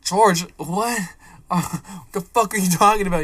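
loud, high incredulous outburst; retold bewilderment. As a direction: re-enact baffled alarm at a friend. Acts own alarmed reply to friend.

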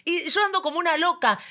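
ordinary talk

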